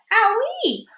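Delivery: very high loud squeal of pain; childish yelp of pain; pained owie. A narrator's reading. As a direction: squeal as her bottom burns. Her bottom is on fire.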